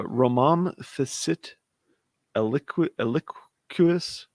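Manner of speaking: Romam Facit Eliquis sounded out slowly, syllable by syllable; every letter of Eliquis spoken slowly and carefully; careful Latin pronunciation. Shown as a demonstration. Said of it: "in Latin I'm supposed to pronounce every letter".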